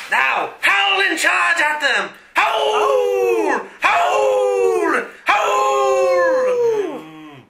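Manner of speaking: slow, high shouted howls; shouted howl orders. Acted. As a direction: yell howl like a mock coyote. Villain urges coyote howls.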